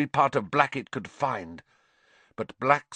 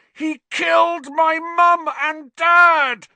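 much higher, loud, slow furious roar; 10 dB louder, slower than usual. A narrator's reading. Harry at the man who killed his parents, furious roar. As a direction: roar it out with all his hate. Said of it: "Harry roared".

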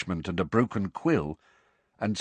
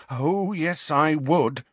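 grim; 7 semitones higher, slower than usual. A narrator's reading. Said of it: "said Hermione grimly".

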